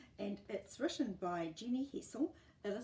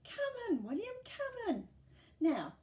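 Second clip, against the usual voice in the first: put-on much higher, louder eager old lady; Edna, welcoming; 13 semitones higher, 7 dB louder than usual. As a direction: usher him in warmly. Edna invites him in.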